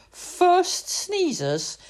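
usual speaking voice

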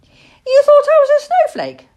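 high, loud, delighted little owl; eager squeaky question; brightening. A narrator's reading. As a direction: pipe up, suddenly thrilled at the idea.

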